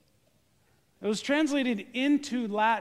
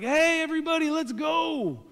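cheerful call; 9 semitones higher, 5 dB louder than usual. Retold or imitated. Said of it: "Jesus is like".

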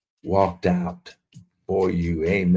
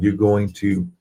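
ordinary talk, nothing marked

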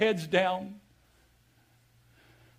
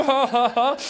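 hammed-up taunting cackle, higher and louder; mock gloating laugh; 6 semitones higher, 6 dB louder than usual. Preacher playing the gloater laughing at the others. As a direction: laugh it in their faces.

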